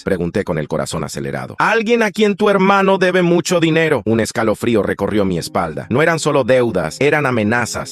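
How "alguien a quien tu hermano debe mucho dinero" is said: menacing loan shark. Acted. Loan shark threatens narrator by phone.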